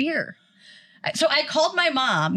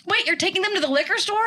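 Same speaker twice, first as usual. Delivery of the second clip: mom's alarm; 8 semitones higher, faster than usual. Retold or imitated. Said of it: "She goes".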